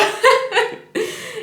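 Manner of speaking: laughing at herself, high; a sudden high giggle; high burst of laughter. Real, not acted. Laughs after forgetting lyrics.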